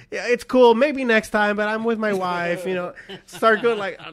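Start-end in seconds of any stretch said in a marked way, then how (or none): 0.1-2.7 higher, loud fake-gracious letdown; mock dismissive. Husband brushing off a woman at a club, mock dismissive. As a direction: let her down with loud, hammed-up sympathy.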